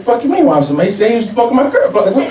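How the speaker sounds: high-pitched angry comeback; indignant anger. Acted. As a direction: protest in heated disbelief. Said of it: "why are you so mad".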